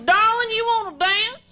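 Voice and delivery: loud-ish, high, drawn-out Texan come-on; mock a big Texan asking for a dance; Texan man. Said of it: "People in Texas hate New Yorkers".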